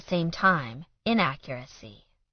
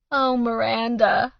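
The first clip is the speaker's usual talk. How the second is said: tearful, slow, higher voice; weeping; 6 semitones higher, 4 dB louder, slower than usual. A narrator's reading. Mother weeping to her daughter, tearful. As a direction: weep her daughter's name. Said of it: "her mom wept".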